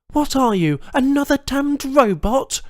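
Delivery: high, loud sneering question; contemptuous. Acted. Captive woman sneering at an intruder, high loud contempt. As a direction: look down on him. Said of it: "she asked contemptuously".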